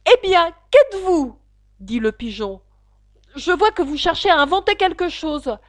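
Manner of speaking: high, loud, sharp questioning; suspicious pigeon. Acted. Pigeon interrogating Alice, high and suspicious. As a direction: demand it like a distrustful bird.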